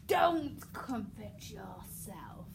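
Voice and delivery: very slow, drawn-out threat; slow menace. Shown as a demonstration. King looming over the girl, slow menacing threat. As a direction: lean in and drag every word. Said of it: "coming round and doing the whole".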